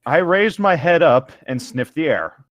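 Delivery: ordinary talk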